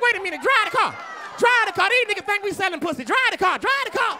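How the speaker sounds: mock-panicked shouting; panicked getaway order. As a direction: act out urgent alarm at the driver. Panicked getaway order in retold drive-by.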